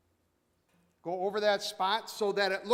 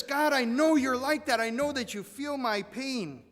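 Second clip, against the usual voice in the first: high, loud cry to God; fervent appeal; 7 semitones higher, 6 dB louder than usual. Acted. Preacher voicing David's appeal to God, fervent and raised. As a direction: call it out at full preaching pitch.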